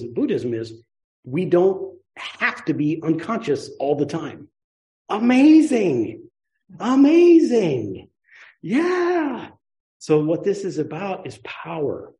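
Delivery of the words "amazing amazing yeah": high, drawn-out, hammed-up wonder; mock amazement. Teacher marvels at not being unconscious, mock amazement. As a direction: stretch each word high and loud in playful awe.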